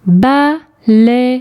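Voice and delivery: "Baleine" split into ba, lei, ne; syllable by syllable. Shown as a demonstration. Teacher correcting the syllable exercise, syllable by syllable. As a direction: sound out "Baleine" in three beats, final e voiced. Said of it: "les syllabes où tu entends".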